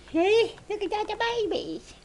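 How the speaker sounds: high-pitched cooing. Real, not acted. Keeper admiring the babies, high baby talk. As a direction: coo high at tiny animals.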